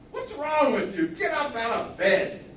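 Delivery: high, loud nagging, slow on get up; wife scolding her husband out of bed. Acted. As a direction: rouse the sulking husband sharply. Wife scolds sulking king.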